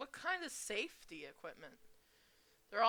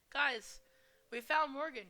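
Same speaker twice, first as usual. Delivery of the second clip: calling loudly to the group, higher; raised calling voice, louder and higher; 6 semitones higher, 7 dB louder than usual. Acted. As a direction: shout it across the room to the others. Calling out to the others.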